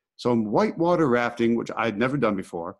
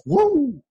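whoosh; 6 dB louder than usual. Retold or imitated. Swept off the boat into rapids.

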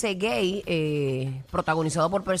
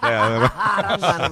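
laughing; 5 dB louder, much slower than usual. Real, not acted. Laughing echo of the joke.

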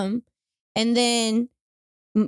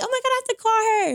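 high, giddy squeal; retold giddy excitement, pitched way up; overjoyed; 12 semitones higher, faster than usual. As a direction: act out bursting to share good news.

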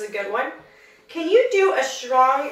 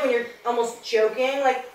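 ordinary talk, nothing marked

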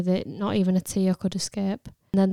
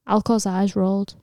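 usual speaking voice

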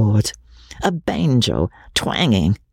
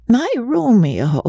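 put-on old woman musing; 12 semitones higher than usual. Old Magnolia recalling past love.

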